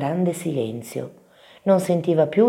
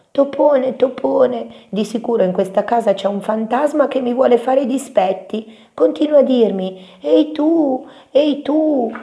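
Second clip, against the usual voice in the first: high, loud scared little mouse; shrieky-high alarm of a small mouse; Topino impaurito che grida; 10 semitones higher, 5 dB louder than usual. A narrator's reading. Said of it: "così gridò". (vocina acuta e spaventata)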